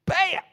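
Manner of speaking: sharp, high, loud "Bam!" for the hit; punch sound effect. Retold or imitated. Retelling the knockout punch.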